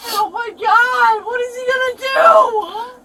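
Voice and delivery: high, slow wail; distraught tearful wail; panicked crying. Real, not acted. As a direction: cry out in real dread. Panicking about getting home.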